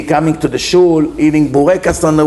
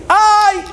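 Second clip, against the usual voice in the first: high, sudden yelp of "ay"; mock yelp as if struck; scream of pain.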